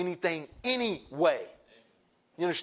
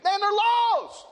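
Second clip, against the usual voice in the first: high drawn out lament; 7 dB louder, much slower than usual. Real, not acted. Preacher lamenting the lost world.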